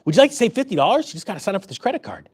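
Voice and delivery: quick, sweet mock cashier upsell; much nicer pitch. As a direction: make the sales offer sound nice, rattled off lightly. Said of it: "They say it much nicer".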